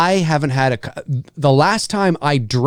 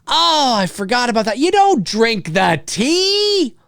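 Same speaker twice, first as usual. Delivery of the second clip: much higher, drawn-out warning; high, slow, incredulous outburst; exasperated exclaiming; 9 semitones higher, slower than usual. Real, not acted. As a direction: wail the advice at the screen. Exclaiming at film character's mistake.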